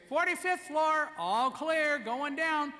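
loud, high call down the stairwell; calling out floors. Retold or imitated. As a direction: sing out the floor number for everyone to hear.

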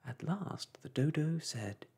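ordinary delivery

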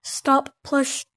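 normal talking voice

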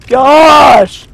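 loud, high, slow "oh my gosh"; exasperated shout. Real, not acted. Player fed up with the narrator's flip-flopping, shouting slowly. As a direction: throw up your hands and yell it out slowly. Narrator keeps changing directions.

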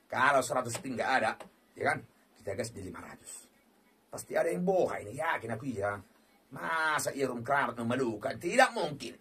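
usual speaking voice

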